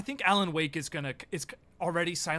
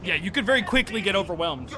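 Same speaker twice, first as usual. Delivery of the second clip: excited; 6 semitones higher, 9 dB louder than usual. Real, not acted. Fighting enemies in game.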